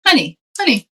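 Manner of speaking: mock-concerned reality check; wise, unruffled brother. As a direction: check on someone falling apart. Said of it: "somebody with wisdom who's not there crying with you".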